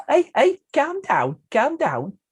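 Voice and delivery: high Scouse "calm down, calm down" impression; Liverpool accent. Shown as a demonstration. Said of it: "I could do your Liverpool accent".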